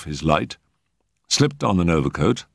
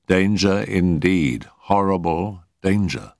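drawn-out danger, slow and heavy; slow ominous emphasis; slower than usual. A narrator's reading. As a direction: linger on danger with dread. Narrator dwells on dread.

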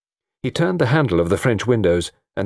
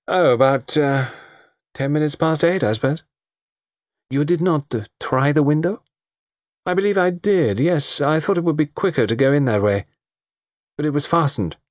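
put-on light, higher, hesitant young woman; hedging her answers; young woman evading Poirot's questions; young woman's voice; 8 semitones higher than usual. A narrator's reading.

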